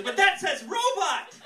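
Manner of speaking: high, loud mock huffy lady; mock angry customer. Angry bakery customer holding back, huffy. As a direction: ham up a furious customer. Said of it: "She goes".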